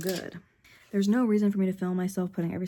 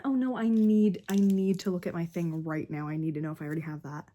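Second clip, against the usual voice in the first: normal talking voice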